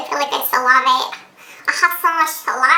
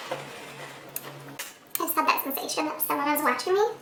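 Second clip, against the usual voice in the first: hushed; 9 dB quieter than usual. Real, not acted. Eerie feeling of being watched.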